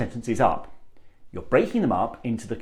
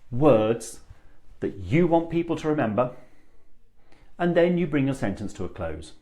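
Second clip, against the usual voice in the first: unhurried phrasing with emphatic pauses; chunked phrasing; 2 semitones higher than usual. Shown as a demonstration. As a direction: break it into chunks with deliberate pauses. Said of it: "I'm adding in those pauses".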